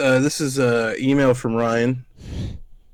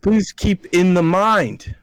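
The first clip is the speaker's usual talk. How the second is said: please keep in the mind, read louder, higher and slower; all caps reading; 7 semitones higher, 5 dB louder, slower than usual. Shown as a demonstration. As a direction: give it the all-caps exclamation. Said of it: "this is in all caps".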